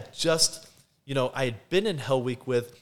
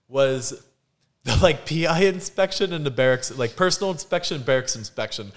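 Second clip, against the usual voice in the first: ordinary delivery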